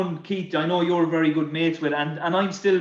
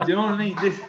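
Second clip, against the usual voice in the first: ordinary talk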